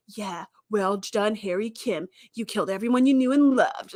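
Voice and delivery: mock-gloomy congratulations; emo Harry. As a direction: play him as a brooding emo, dragging the words. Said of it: "He was very emo".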